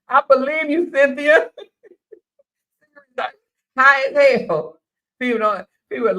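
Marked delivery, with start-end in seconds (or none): 0.1-1.5 higher and louder, teasing disbelief; mock sarcasm. Real, not acted. Host teasing a caller who says drinking is cheaper, sarcastic. 3.8-4.8 higher, louder, slow; teasing punchline. Real, not acted. Host mocking a caller who sounds high, slow and louder.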